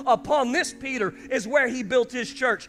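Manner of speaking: rising to a loud high proclamation; building preaching. Acted. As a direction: lift the congregation toward purpose.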